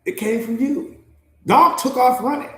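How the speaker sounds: ordinary delivery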